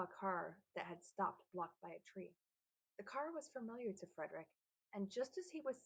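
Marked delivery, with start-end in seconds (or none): none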